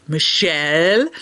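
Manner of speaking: slow, drawn-out mock scolding of the name; scolding doctor. Doctor scolding his patient about donuts, slow and drawn out. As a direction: stretch "Michelle" like a disappointed doctor. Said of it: "when he gets mad at me".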